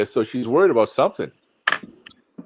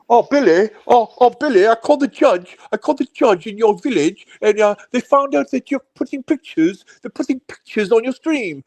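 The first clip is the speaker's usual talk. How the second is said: high-pitched put-on caller taunting Billy; hammed-up high-pitched tattling; mock streamer impression; 7 semitones higher than usual. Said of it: "he's probably yelling right now on his stream".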